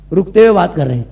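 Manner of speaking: ordinary delivery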